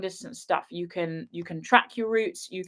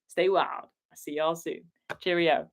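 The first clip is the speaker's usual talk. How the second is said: normal talking voice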